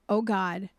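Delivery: ordinary delivery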